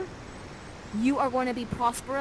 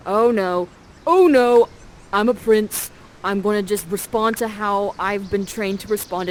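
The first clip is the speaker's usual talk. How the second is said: much louder, flustered alarm; panicked prince; 9 dB louder than usual. Acted. Ferdinand caught by Prospera, loud panic. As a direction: blurt it out in a panic.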